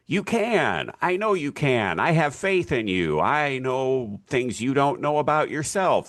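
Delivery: high-pitched, gushing pep talk; teacher's eager, high-pitched reassurance; earnest encouragement. Acted. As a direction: cheer on a doubting student with total faith. Eager teacher encouraging doubting students.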